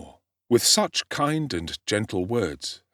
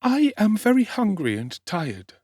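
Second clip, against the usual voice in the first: put-on higher young boy's voice, near tears; tearful orphan boy; 12 semitones higher, 3 dB louder than usual. A narrator's reading. Starving orphan answering the street boy, tearful. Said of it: "replied Oliver, the tears standing in his eyes".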